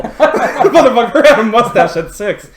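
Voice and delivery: laughing through the punchline; laughing at his own joke. Real, not acted. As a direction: crack up on the joke.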